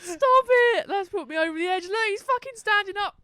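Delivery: high, loud, overcome with amusement; real giddy outburst; hysterical disbelief. Real, not acted. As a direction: cry out as if pushed over the edge. Hysterical reaction to the game clip.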